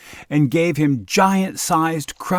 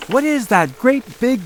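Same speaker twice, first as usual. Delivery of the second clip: high, startled mother; mother seeing the giant's boot, high alarmed cry; 12 semitones higher, 5 dB louder than usual. Acted. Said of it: "she cried".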